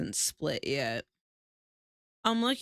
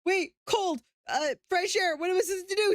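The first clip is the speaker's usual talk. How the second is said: high flustered rush; retold panic; 12 semitones higher, 3 dB louder, faster than usual. Streamer retelling her panic over a yawn. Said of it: "I was like".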